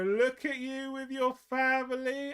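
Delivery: put-on high, slow fond old nan; doting nan. Confused nan praising grandson's family, high and fond. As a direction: gush over him like a proud gran. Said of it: "And then she'll go".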